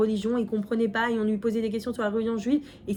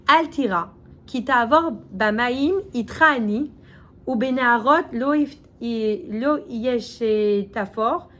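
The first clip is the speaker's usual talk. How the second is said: louder, measured recitation of scripture; verse recital; 3 dB louder, slower than usual. Acted. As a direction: declaim the Hebrew verse slowly. Reciting Hebrew verse aloud.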